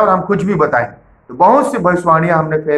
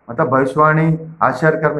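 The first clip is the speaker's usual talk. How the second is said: ordinary talk, nothing marked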